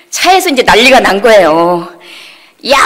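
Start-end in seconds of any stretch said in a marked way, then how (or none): 2.7-2.8 mock yell, pitched up briefly; retold angry outburst. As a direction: imitate mom's sudden angry "hey". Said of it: "이루 말할 수 없는 얘기를 막 쏟아내면서".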